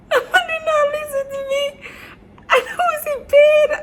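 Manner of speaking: high, breaking voice, half sob, half laugh; laugh-cry through the memory of the pain; crying while laughing. Real, not acted. To her viewers, reliving the labor, cry-laughing. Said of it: "I started crying".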